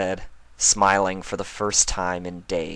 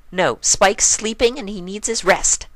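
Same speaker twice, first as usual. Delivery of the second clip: high, louder flat refusal; firm Pinkie; 14 semitones higher, 7 dB louder than usual. A narrator's reading. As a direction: stand guard at the door. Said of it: "she stated".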